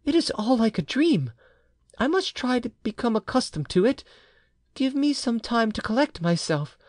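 high, faltering wife begging for time; hesitant wife. A narrator's reading. Uneasy wife asks her husband for time, high and faltering. Wife's voice held in dialogue.

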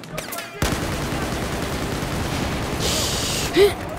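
high unvoiced gasp of shock; sharp gasp. Real, not acted. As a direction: gasp in surprise. Sudden in-game shock.

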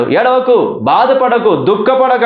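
high-pitched, impassioned plea; impassioned exhortation. Acted. Preacher passes the Lord's comfort to a grieving listener, impassioned. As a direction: urge it in a high, rising preacher's cry.

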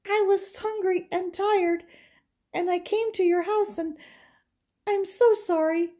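put-on high, pleading little girl; scared girl. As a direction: beg for forgiveness in a frightened high voice. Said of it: "she was scared".

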